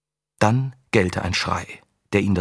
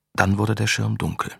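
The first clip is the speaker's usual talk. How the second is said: ordinary delivery, nothing marked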